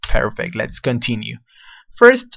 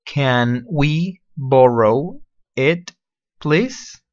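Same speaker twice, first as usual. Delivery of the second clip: "Can we borrow it, please?" very slow, rising on please; slow model phrase; much slower than usual. Shown as a demonstration. Teacher slowly modeling "Can we borrow it, please?". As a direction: drag it out so they hear each word. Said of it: "Listen to the pronunciation first".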